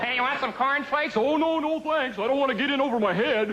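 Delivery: high laughing mock TV ad voices; mock commercial dialogue. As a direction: ham up the cereal ad. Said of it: "Will Rogers Junior for Grape Nuts".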